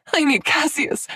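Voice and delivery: high, loud sobbing plea. A narrator's reading. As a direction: sob it out desperately. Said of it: "she sobbed".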